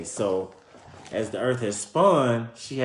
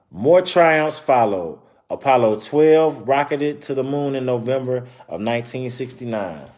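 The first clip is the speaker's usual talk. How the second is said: story narration; 3 semitones higher, 6 dB louder than usual. Acted. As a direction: clear read-aloud storytelling voice. Reading picture book aloud.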